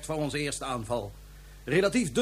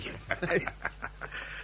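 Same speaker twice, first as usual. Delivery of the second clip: soft villain chuckle; 10 dB quieter than usual. Acted. Villain gloats over weapon.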